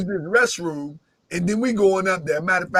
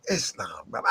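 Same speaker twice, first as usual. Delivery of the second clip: low, soft "Islam, brother"; imitating soft talk; 11 semitones lower, 12 dB quieter than usual. Braswell Bey greeting him: mock soft, low murmur. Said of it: "they talk real soft".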